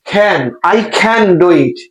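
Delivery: CAN stretched slowly on a long A; long A sound. Shown as a demonstration. Teacher modelling the sentence, CAN held long. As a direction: draw CAN out, slow and deliberate. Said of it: "লম্বা এ sound".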